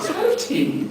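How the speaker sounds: very high, dainty mock repeat; mocking echo. Caller echoing the quaint tea invitation, mocking. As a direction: squeak the phrase back as a teasing question.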